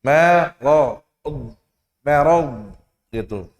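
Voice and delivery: Merod with a thin e instead of a; thin reading of marad; thin pronunciation. Shown as a demonstration. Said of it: "juga sama".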